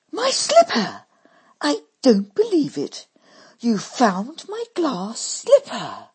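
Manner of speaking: loud, high cry of joy; overjoyed at the find; loud amazed Dolly; amazed delight. A narrator's reading.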